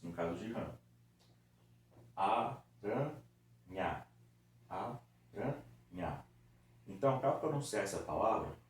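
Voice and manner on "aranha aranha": "Aranha" very slowly as a, ra, nha; syllable by syllable. Shown as a demonstration. Teacher separating the word into three parts, "Aranha" syllable by syllable. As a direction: clear pauses between the three parts of "Aranha". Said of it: "eu acabei separando ela".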